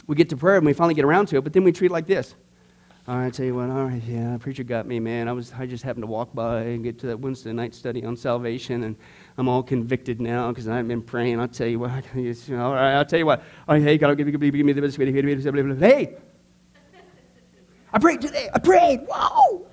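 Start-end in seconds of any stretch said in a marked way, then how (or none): 3.1-15.8 lower, softer half-hearted guy mumbling a prayer; reluctant churchgoer. Retold or imitated. Acting lazy reluctant prayer. 15.8-19.7 mock proud cheer. Mock pride after praying.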